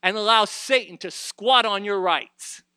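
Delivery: high, loud, driving sermon delivery; preaching at full force. Acted. Preacher urging the church to take their inheritance, full-force preaching. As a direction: thunder it out to the congregation.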